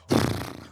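loose-lipped raspberry; flappy-lip noise. Shown as a demonstration. Showing what flappy lips sound like, flapped lip noise. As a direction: blow air through floppy lips. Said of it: "like flappy lips. You know, like".

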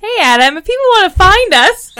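high, loud announcer voice; turning the outro line on a co-host; mock podcast sign-off. Co-host hams up the outro, high and loud. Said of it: "See how I turned it on you?".

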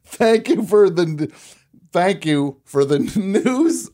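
laughing through the words. Real, not acted. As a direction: giggle while you say it. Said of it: "look at that".

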